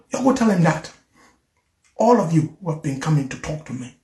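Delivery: ordinary delivery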